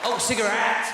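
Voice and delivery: "cigarette" high, loud, every vowel sounded; over-enunciated. Retold or imitated. South African realising he was misunderstood, "cigarette" over-enunciated. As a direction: spell out "cigarette" with full vowels. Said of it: "I should have enunciated".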